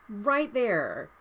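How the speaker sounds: usual speaking voice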